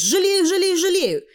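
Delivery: put-on high, loud childish protest; little girl insisting loudly; insistent girl. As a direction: pipe it up high, repeating eagerly. Voices the girl Tanya.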